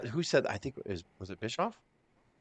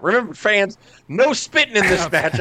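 higher, louder, slow announcer call; mock ring announcer; 6 semitones higher, 5 dB louder, slower than usual. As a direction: announce it to the arena.

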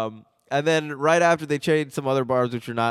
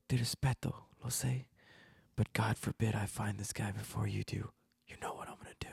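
hushed impression, sinking into a whisper; Steven Seagal; 2 semitones lower, 11 dB quieter than usual. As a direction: start softly in Italian, end almost all breath. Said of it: "And Steven goes".

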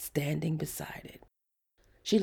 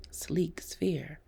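ordinary delivery, nothing marked

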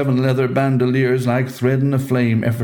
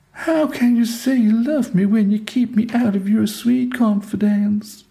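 Delilah reproaching; 12 semitones higher than usual. Acted.

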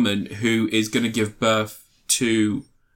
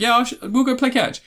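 high goofy voice; 12 semitones higher than usual. Retold or imitated. Retelling comedy scene, character voice.

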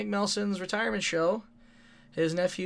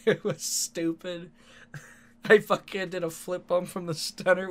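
ordinary talk

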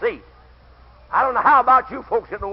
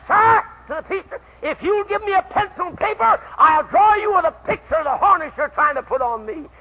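raised, high-pitched comeback; mock indignant retort; 9 semitones higher, 6 dB louder than usual. Preacher retelling someone's retort to the preacher, raised indignant voice. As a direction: call it out high to the room.

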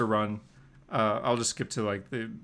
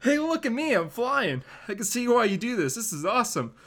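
higher, excited crowing; gleeful flying; 8 semitones higher than usual. Acted. Peter delighted flying the goblin glider. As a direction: shout with joy of flight.

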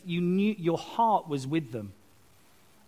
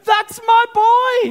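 much higher, much louder, slow stretched cry; ecstatic dad cheer; 14 dB louder, slower than usual. Retold or imitated. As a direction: bellow it with pure fatherly pride. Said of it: "he's going like that".

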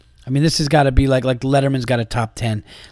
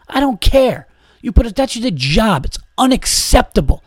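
indignant rant; 8 semitones higher than usual. Retold or imitated. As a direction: let the outrage boil over at the bartender. Ranting at a rude bartender.

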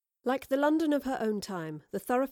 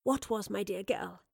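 gentle. A narrator's reading. Crouches beside crying child.

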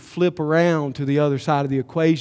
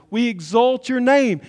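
loud, higher praise cry; the first call of worship, already loud; mock worship to an idol; 8 semitones higher, 7 dB louder than usual. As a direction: proclaim it up toward the idol. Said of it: "what we're saying to the idol is".

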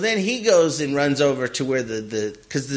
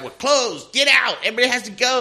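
mock order; 8 semitones higher than usual. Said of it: "And she comes out".

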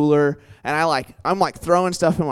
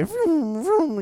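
high vroom of passing cars; car whooshing past; 14 semitones higher than usual. Retold or imitated. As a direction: imitate traffic zooming by.

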